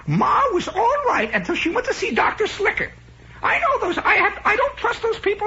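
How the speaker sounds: very high falsetto aunt, ranting; hammed-up aunt. Aunt railing about doctors to her sister, mock falsetto rant. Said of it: "my Aunt Teresa saying".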